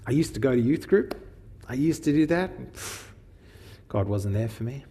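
mock avoidant shrugging people off; avoidant brush-off. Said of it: "is avoidant distancing".

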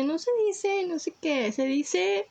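put-on fresa voice, correcting someone; fresa girl. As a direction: imitate a snooty fresa girl. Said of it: "La morra, la morra que es bien fresa".

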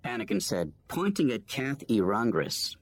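usual speaking voice

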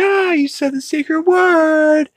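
very high, squealed mock alarm; mock game-show scream. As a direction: shriek it like a kids' show catchphrase. Cartoon's secret-word alarm acted out.

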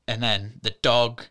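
ordinary talk

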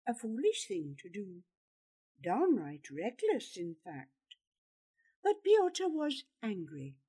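plain reading voice

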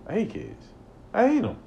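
high, loud mock fury; exasperated outburst. Real, not acted. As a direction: vent it like a fed-up uncle. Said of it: "I'm not ranting".

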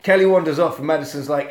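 ordinary talk

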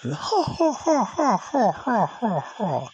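high falsetto ha-ha cackle, sliding down; exaggerated high mocking laugh; mock funny laugh. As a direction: ape the coworker's odd giggle. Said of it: "And he laugh funny".

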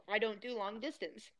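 words caught in a tight throat; choked up by something stuck in the throat. Real, not acted. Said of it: "my voice got all tight".

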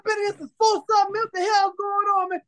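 high-pitched retold griping; high, exasperated retelling of a gripe; indignant complaint. Retelling his complaint about waiting.

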